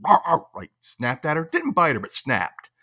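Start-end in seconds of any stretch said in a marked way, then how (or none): 0.1-0.4 a louder, higher playful growl; mock doggy snarl, rawr rawr; imitated dog snarl. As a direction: snap like a nipping dog. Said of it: "Charlie went".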